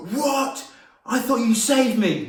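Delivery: very high, loud outraged squeal; mock shocked disbelief. Brother learning of the prank, high mock shock. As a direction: imitate a betrayed brother's astonishment.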